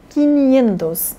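"quinhentos" said as Spanish "quinientos", with N, slowly; Spanish pronunciation. Shown as a demonstration. Teacher models the Spanish five hundred slowly. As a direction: say "quinhentos" the Spanish way, without the NH sound. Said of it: "em espanhol é com N".

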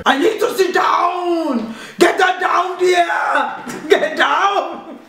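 high, drawn-out mock action-hero shout; Arnold Schwarzenegger. Mum attempting a film star, stretched hammy shout. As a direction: bellow it slowly with a put-on Austrian accent. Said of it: "Arnold Schwarzenegger".

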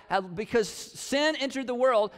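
ordinary talk

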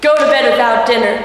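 loud, angry order; father furious that she turned her back on him; enraged father. A narrator's reading. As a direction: send her off to bed in fury. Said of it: "Dad became enraged".

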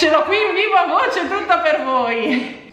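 slow, laughing announcement; excited, laughing. Real, not acted. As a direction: present her with a laugh. Cheerful on-air intro of the guest. (annuncio allegro e ridente)

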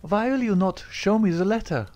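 high, insistent cry; cried out. A narrator's reading. Etty demands to see the letter, crying out. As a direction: demand it of him. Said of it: "she cried".

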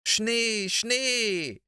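put-on high, eager cry of little kids; children's excited high shout; excited children calling. A narrator's reading. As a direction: call out in delight at the snow. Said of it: "riefen sie".

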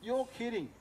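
high-pitched retold disbelief; stunned disbelief. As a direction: act out being thoroughly taken aback. Acted shocked disbelief.

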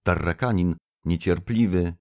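usual speaking voice

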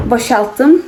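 usual speaking voice

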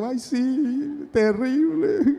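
high, hammed-up whimper; seeking pity. Invalid milking sympathy on the phone, mock whining. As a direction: whine it like a mock victim. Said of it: "porque uno busca la lástima".

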